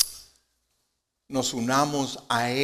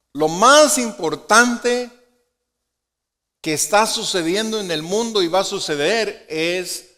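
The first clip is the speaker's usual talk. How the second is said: preaching at full force; 5 semitones higher, 8 dB louder than usual. Real, not acted. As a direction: stretch it out like a proclamation. Preacher building to climax. (proclamando, alto, lento y fuerte)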